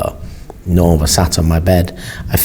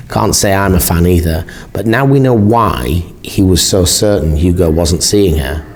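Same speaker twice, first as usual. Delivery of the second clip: ordinary delivery, nothing marked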